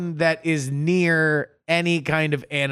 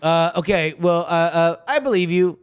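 ordinary talk, nothing marked

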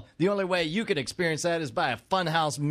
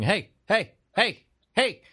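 HEY barked in short, higher bursts; repeated yappy HEY, imitating a dog; dog barking "hey"; 5 semitones higher, 4 dB louder, slower than usual. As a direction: bark the word HEY like a dog. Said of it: "every dog just going".

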